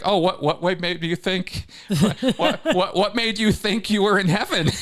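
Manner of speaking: high, loud, laughing mockery; laughing guide. Guide laughing at the thief, high and loud. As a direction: cackle through the question. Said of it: "the guide starts laughing".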